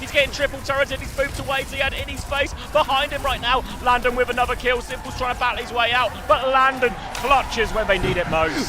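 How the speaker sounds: high, loud hype play-by-play; excited esports caster. Real, not acted. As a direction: yell the clutch play. Hype casting of teamfight.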